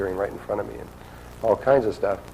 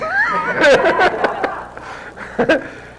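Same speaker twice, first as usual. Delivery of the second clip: laugh; 5 dB louder than usual. Real, not acted. Laughing at the memory.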